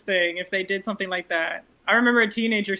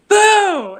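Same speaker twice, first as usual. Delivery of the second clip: loud, high mock scare yell; imitated boo; 10 semitones higher, 8 dB louder than usual. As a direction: burst out as if to startle someone. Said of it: "she tried to scare me. She's like".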